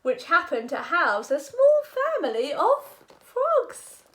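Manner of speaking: put-on lively sing-song read-aloud for kids; animated picture book narration; storybook reading. A narrator's reading. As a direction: read it like bedtime story time.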